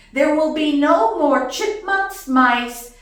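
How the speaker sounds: firm, loud, measured ultimatum; acted scolding announcement; stern decree to cats. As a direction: lay down the law, item by item. Lays down law to cats.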